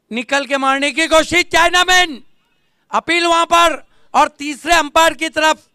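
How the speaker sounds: loud, high-pitched excited appeal call; live play-by-play. Real, not acted. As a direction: burst out at the big moment, easing slightly as it goes. Appeal in live cricket commentary. (ज़ोरदार, ऊँचे सुर में रोमांचक cricket commentary)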